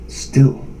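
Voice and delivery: ordinary talk, nothing marked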